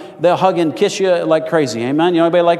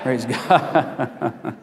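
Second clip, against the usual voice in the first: amused aside; 4 semitones lower, 4 dB quieter than usual. Real, not acted. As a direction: praise breaking into a chuckle. Jokes about the Pentecostal shout.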